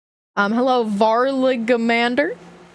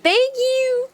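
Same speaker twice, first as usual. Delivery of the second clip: chirpy thanks; 13 semitones higher than usual. Real, not acted.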